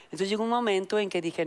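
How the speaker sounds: ordinary delivery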